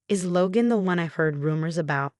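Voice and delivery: ordinary delivery, nothing marked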